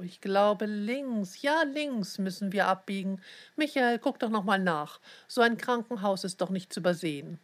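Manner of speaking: usual speaking voice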